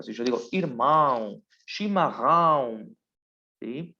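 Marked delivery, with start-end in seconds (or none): 0.5-1.4 "irmão" ending in a nasal ão; nasal Portuguese vowel. Shown as a demonstration. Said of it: "Los sonidos nasales, por ejemplo". 1.7-3.0 slowly, "chimarrão" with nasal ão and throaty rr; slow nasal Portuguese. Shown as a demonstration. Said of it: "Los sonidos nasales, por ejemplo".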